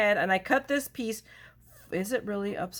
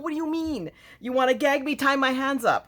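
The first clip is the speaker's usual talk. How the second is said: higher, mock-offended retort; retorting to her husband, hammed-up indignation; mock outrage; 6 semitones higher, 3 dB louder than usual. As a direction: play up being silenced, indignant. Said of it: "And I go".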